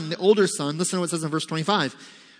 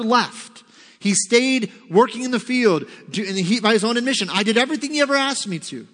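much higher, louder, rushed indignant complaint; indignant older son; 6 semitones higher, 4 dB louder than usual. Pretend. Pastor voices the angry older brother complaining, heated. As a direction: protest hotly, voice climbing. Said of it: "He's angry".

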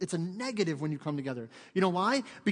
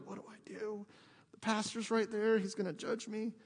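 higher, hushed, torn worry; anxious inner voice; 4 semitones higher, 4 dB quieter than usual. Retold or imitated. Woman afraid the pastor will judge her, anxious hush. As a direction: imitate someone fretting under their breath. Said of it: "like, feeling moved".

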